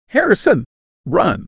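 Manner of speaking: loud, high-pitched urgent shout; full-out cry of alarm; shouted warning. A narrator's reading. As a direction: yell to send someone fleeing. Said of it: "I shouted".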